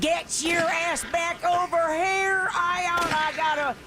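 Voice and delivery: high, shrill-pitched furious holler; angry yelling. Acted. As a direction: yell like an armed angry landlady. Woman with shotgun yells at him.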